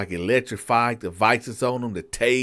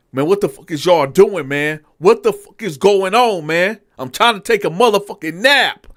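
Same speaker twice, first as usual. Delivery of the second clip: irritated inmate; 7 semitones higher, 7 dB louder than usual. Retold or imitated. Inmate sees the riot team.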